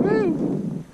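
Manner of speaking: high whoop of joy; cheer. Real, not acted. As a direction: let out a happy woo. Cheering at catch.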